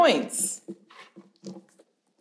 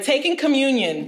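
ordinary talk

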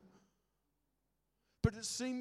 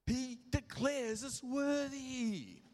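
stretched out slow and weighty; slow emphatic declaration; 5 semitones higher, much slower than usual. Real, not acted. Preacher slowing down to declare worth to the congregation, slow and emphatic. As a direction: lean on every word slowly. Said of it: "I'm getting excited and I'll have to slow down".

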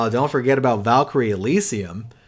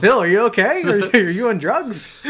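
laughing; 8 semitones higher, 6 dB louder than usual. Real, not acted. Laughing at impression.

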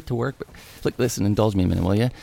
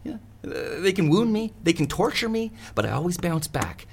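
high, defiant self-dramatizing; mock-heroic bravado; 7 semitones higher than usual. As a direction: proclaim invincibility, pitched up. Plays unbeatable rejection hero.

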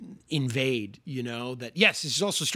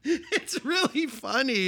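high, laughing through the words; 11 semitones higher than usual. Real, not acted. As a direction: giggle while saying it. Laughing at the scene.

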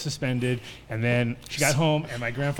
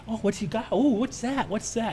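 nosy grandmother; 9 semitones higher than usual. Retold or imitated. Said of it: "So she's all in".

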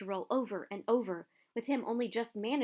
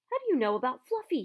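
startled question; 7 semitones higher, 5 dB louder, faster than usual. Acted. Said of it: "he said".

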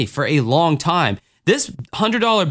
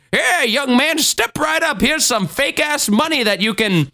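put-on old-timey carnival barker; 5 semitones higher than usual. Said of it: "they're like".

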